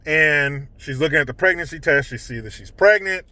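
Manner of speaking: ordinary talk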